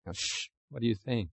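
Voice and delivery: hushed shushing. Retold or imitated. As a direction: shush them, alarmed and soft. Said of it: "some are".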